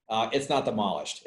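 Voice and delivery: ordinary talk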